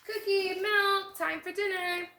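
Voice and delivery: high, loud call across the house; mom calling out. Acted. Mom calling the girls in for dinner, loud.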